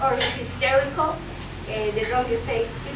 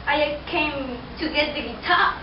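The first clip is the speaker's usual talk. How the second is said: ordinary talk, nothing marked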